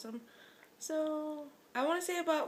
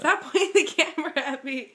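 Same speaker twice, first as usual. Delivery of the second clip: loud giggly objection, pitched up; higher, laughing complaint at being filmed; laughing protest; 4 semitones higher, 8 dB louder than usual. Real, not acted. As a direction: protest loudly through a real laugh.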